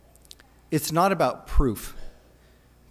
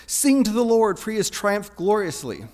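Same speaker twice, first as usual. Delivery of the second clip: joyful proclaiming; 6 semitones higher, 6 dB louder than usual. Acted. Women's victory song quoted.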